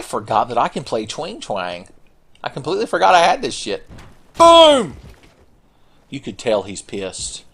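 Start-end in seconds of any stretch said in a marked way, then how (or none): 4.4-5.0 high, loud shout; exclaiming. Real, not acted. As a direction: shout it like an explosion. Fires weapon in game.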